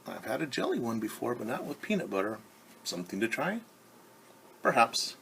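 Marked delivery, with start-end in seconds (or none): none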